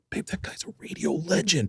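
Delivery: hushed aside. Retold or imitated. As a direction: lean over and tell her quietly. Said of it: "And I was like".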